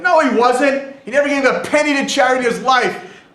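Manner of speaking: loud, high mock outburst; yelled heckle. Mourner contradicts the rabbi's eulogy, yelled objection. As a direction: shout down the eulogy. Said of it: "Somebody yells out".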